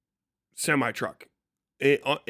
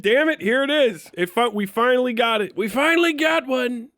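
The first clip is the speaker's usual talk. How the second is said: higher, hammed-up glee at the badge; mock triumph; 8 semitones higher than usual. Seeing the FBI badge at his door, mock triumphant cry. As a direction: crow it like you finally got caught. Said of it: "and I'm like".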